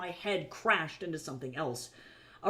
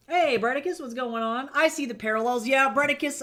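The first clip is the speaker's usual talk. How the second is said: excited greeting; 7 semitones higher, 6 dB louder than usual. Real, not acted. Greeting new chatter excitedly.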